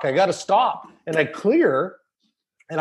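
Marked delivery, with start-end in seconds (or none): none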